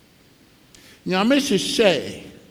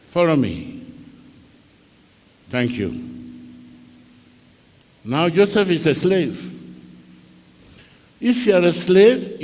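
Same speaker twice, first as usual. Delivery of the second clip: usual speaking voice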